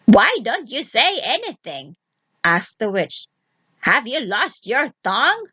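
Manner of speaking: mocking witch voice; taunting old witch. Witch mocking Vasilisa's silence. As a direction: needle the silent girl.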